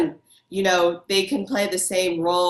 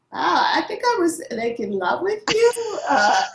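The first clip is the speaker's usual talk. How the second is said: giggly confession; 8 semitones higher than usual. Retold or imitated. Confessing a crush to an actor.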